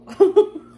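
a loud high laugh; burst of laughter. Real, not acted. Baker laughing at her own flat pancake, loud laugh.

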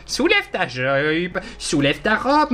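playfully sleazy leer, rising at the ends; mock creep voice. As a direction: ham up a sleazy creep, drawing it out. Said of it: "ça fait un petit peu pornographique".

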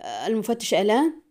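usual speaking voice

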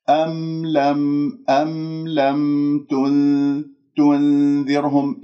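slow "تنذرهم", the ن free of rounding; clean nun. Shown as a demonstration. Teacher models for students, slow precise recitation. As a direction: recite level and drawn-out, clear م and pure ن. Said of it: "أنا خلصت النون من كلمة".

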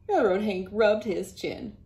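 usual speaking voice

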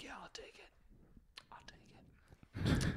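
hushed, breathless whisper; mock whispered eagerness. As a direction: whisper it like a secret deal. Whispered quote of himself.

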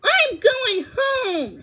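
high, loud refusal; shouted refusal rising in pitch; defiant declaration. A narrator's reading. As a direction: declare it defiantly, drawing out the last words. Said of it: "she declared".

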